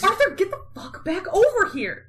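high, scolding call; exasperated order. Retold or imitated. John ordering Arthur back, exasperated.

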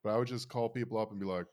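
ordinary talk

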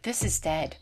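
low, soft, dead calm deadpan; calmest voice. Retold or imitated. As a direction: say it utterly unbothered, quietly. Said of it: "in the calmest voice".

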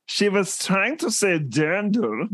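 mock German accent. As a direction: lecture in a put-on German accent. Explains in put-on German accent.

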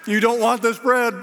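high, loud, insistent refusal; urgent warning. Retold or imitated. Preacher warns lead pastor off soggy bread, urgent. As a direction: warn him off with rising urgency.

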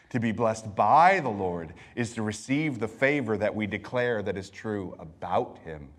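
ordinary talk

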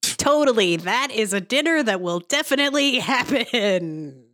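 mock enthusiasm, stretched and lilting; sarcastic. Real, not acted. Host reacts to a scammer's dinner promise in the clip, sarcastic. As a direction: oversell it with obvious disbelief.

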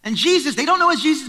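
usual speaking voice

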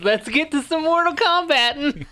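high-pitched mock battle cry; hammed-up catchphrase. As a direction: parody a corny cartoon hero. Repeats the mocked cartoon catchphrase.

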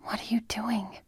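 hushed, breath-only question in the dark; whispered. A narrator's reading. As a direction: whisper it so nobody wakes. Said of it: "Andy whispered".

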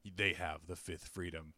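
low, slow movie-trailer voice; trailer narrator voice. Retold or imitated. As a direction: read it like a dramatic game ad. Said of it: "the ads for the very first Splinter Cell were like".

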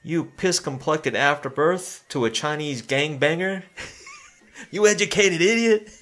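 half-laughing mock insult, rising to a high yell; retold insult. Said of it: "He goes".

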